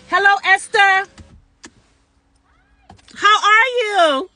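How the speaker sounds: high, loud call across the room; excited greeting. Real, not acted. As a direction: greet a friend arriving, delighted. Calling out to arriving friend.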